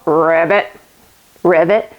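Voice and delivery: lightly voiced ribbit; frog croak. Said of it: "let's become a frog".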